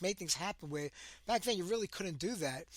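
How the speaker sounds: ordinary delivery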